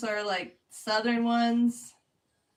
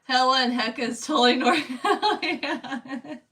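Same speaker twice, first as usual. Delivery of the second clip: louder, breaking into laughter; real amused laughter; 2 semitones higher, 6 dB louder than usual. Real, not acted. Streamer reacting to chat about hella and hecka, laughing. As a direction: start strong and dissolve into laughing.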